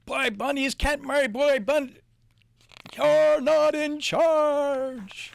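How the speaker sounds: high, loud and very slow bellow; bossy bug losing control, shouting; angry stink bug. Acted. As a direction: drag it out in outrage. Stink bug furious, overruled.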